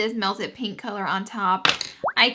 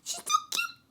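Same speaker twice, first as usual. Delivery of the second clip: cutesy gush; 14 semitones higher than usual. Real, not acted. Creator gushing to viewers about collab partner, squealy cutesy voice.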